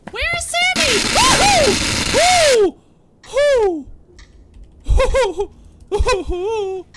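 very high loud hooting; silly high hoots. Pretend. Gamer fooling around calling for Sammy, high hoots. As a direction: hoot like a goofy owl.

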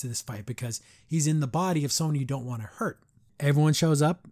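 ordinary talk, nothing marked

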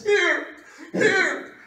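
loud, high cries of blessing; imitated tearful, urgent hollering; mock weeping shout. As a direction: act out an overwhelmed man giving everything away. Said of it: "he's weeping, crying".

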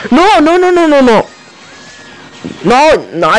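high, fast, frantic protest; panicked pleading as he dies. Real, not acted.

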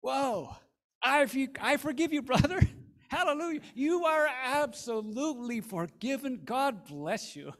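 high, loud, laughing delight; overjoyed at the money, laugh through it; thrilled. Acted. Nancy thrilled by the check.

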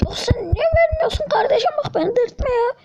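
high, whimpering plea at the game; squeaky near-tears begging; crying whine. Real, not acted. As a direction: whine like you're about to cry.

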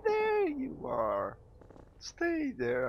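high, drawn-out creepy call; playful stalker. Pretend. Seeker calls to hiding player, playful stalker.

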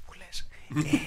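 very soft, secretive murmur; hushed. Pretend. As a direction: drop right down as if someone is sleeping. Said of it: "μπορούμε να μιλάμε πιο σιγά".

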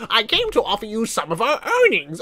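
lightly acted weary old granny; granny character voice; tired granny. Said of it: "She looks so tired".

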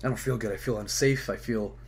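hurting all over, weak; sore muscles. Real, not acted. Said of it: "Everything hurts right now".

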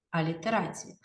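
ordinary talk, nothing marked

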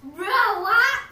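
loud, high shocked outburst. Real, not acted. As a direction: blurt it out, stunned. Shocked at friend's fever.

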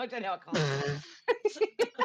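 laughing. Real, not acted.

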